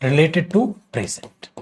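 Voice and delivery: very slow, word by word; each word drawn out as if dictating; slow dictation. Shown as a demonstration. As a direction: spell it out slowly for the class. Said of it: "complete action".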